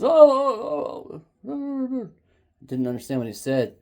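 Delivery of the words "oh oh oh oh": loud, high wailed exclamations, easing off; panicking neighbor. Retold or imitated. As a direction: imitate the neighbor's garbled alarmed cries. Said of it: "He goes".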